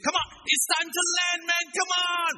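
high, loud coaxing shout; urging shout. Retold or imitated. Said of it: "brothers and sisters say".

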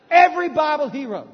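shouted out at full force. Real, not acted. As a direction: belt it to the congregation. Preacher shouts peak line.

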